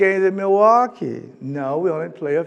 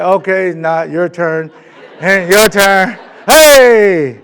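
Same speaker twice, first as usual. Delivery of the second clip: louder, drawn-out calls; family calling turns; 11 dB louder, slower than usual. Retold or imitated. Family crowding the tiny TV, calling out loud. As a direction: shout for the next in line. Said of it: "Everybody's like".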